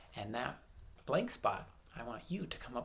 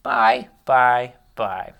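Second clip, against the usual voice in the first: loud drilled bye; 16 dB louder, much slower than usual. Shown as a demonstration. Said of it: "this bye".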